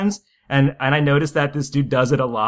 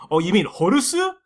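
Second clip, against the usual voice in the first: higher-pitched, over-Japanified correction; mock Japanese accent; 7 semitones higher than usual. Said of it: "you wouldn't be like".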